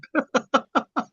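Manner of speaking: high, breathy burst of laughter at her own joke; real laugh. Real, not acted. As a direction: let a real laugh escape, high and light. Laughs at own judging story.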